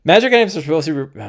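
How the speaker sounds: rushed, words tumbling into each other; talking too fast. Real, not acted. Streamer rushing through his point about magic items, talking too fast. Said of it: "I'm talking too fast".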